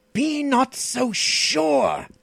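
high, slow, drawn-out menace; hateful threat. A narrator's reading. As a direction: draw out the warning with fierce hatred. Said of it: "The fiendish hatred of the Stygian".

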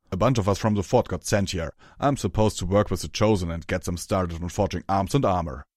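loud, rough character voice; Hammer Chain's gruff voice. Acted. Hammer Chain explains his posting to Rarity, loud gruff voice. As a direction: explain it loudly and bluntly.

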